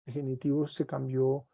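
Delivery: ordinary talk, nothing marked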